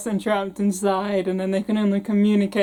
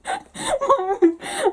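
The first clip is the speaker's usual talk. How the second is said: helpless laughter. Real, not acted. Cracks up at own voice.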